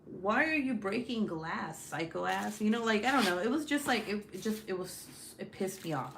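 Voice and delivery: ordinary delivery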